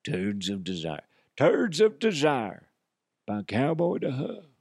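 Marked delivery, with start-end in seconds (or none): 1.4-4.5 commercial announcer. Acted. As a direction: sell Turds of Desire like a perfume ad. Pitching a fake commercial.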